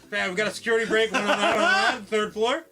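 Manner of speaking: high, loud, laughing alarm; mock urgent callout. Official calling for reinforcements, mock urgent shout. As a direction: call in backup, barely holding laughter.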